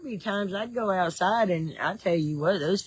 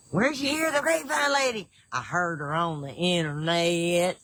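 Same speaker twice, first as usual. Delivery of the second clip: Southern accent catchphrase. Shown as a demonstration. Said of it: "You love that accent?".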